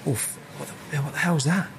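a mock stunned reaction to the taste; imitated astonishment. John tastes the dish, astonished.

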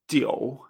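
"Jiu" low, sounding like ji-o-u; correct pronunciation. Shown as a demonstration. Teacher repeats the corrected example, correct pronunciation. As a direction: keep an o inside "Jiu". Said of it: "sino que es you".

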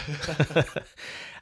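short high laugh; real amused laugh. Real, not acted. Laughs at own name joke.

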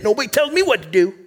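put-on quoting a stubborn loner in a Maine accent. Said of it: "a wow from my Mainer accent".

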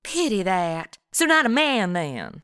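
mock southern drawl; southern accent. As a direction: talk like the desk clerk. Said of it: "I always start talking like 'em".

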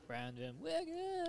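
slow, high announcer flourish on the surname; hyped announcer introduction. Real, not acted. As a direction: introduce your co-host with a long, rising call. Introducing co-host with a drawn-out name.

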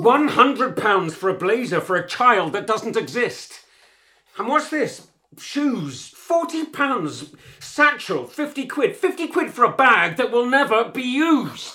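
high, loud rant at a spouse; angry tirade. Acted. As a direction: build in exasperation item by item. Husband rows about wife's spending.

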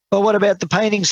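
very high-pitched outcry; dismayed protest. A narrator's reading. Crowd to auctioneer as the sale ends, high dismayed protest.

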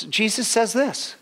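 ordinary delivery

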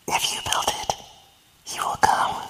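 hushed, breathy voice from the corn; mysterious whisper. Retold or imitated. The voice in the corn calls to Ray, whispered. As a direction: whisper it like a ghostly voice on the wind. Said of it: "and then he just hears".

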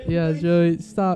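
giggly high protest; laughing while telling him to stop. Real, not acted. Laughing at Joey.

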